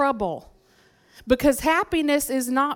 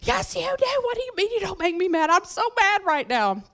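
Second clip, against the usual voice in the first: put-on much higher, squealing teen protest; indignant teenager; 8 semitones higher than usual. Ninth-grade girl arguing with dad, high mock outrage. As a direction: whine it at dad, fast and outraged. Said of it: "and I said".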